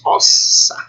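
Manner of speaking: Ossa with the s held long; "Ossa" split on a lengthened double s; both s's pronounced. Shown as a demonstration. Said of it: "ambos pronunciados".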